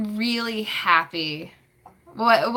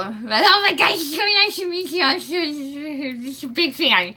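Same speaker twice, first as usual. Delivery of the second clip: higher, breathless starstruck fan gush; gushing fangirl; 6 semitones higher than usual. Pretend. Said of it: "what was that fangirl".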